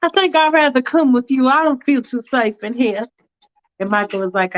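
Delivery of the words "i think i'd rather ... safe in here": higher, prim and hesitant; a fussy woman hedging politely; Faith's fussy voice. Acted. Faith hesitating to the driver, prim and higher. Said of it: "She's like".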